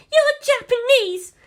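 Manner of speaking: very high, loud screech. A narrator's reading. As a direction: screech it at them in fury. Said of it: "she screeched".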